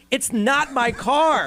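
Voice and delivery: high, drawn-out indignant protest; exasperated protest. Retold or imitated. Homeowner insisting to the man at the door, exasperated. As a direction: stretch each word out in exasperation. Acted doorbell quarrel.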